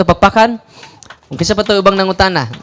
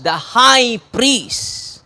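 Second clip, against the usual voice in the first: slow emphatic answer; 3 semitones higher, much slower than usual. Shown as a demonstration. Answering own question to crowd.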